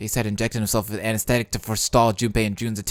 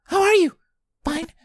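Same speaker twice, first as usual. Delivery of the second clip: put-on worried girl voice; 4 dB louder than usual. Voices June.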